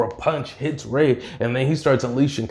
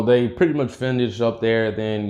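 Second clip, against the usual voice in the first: plain reading voice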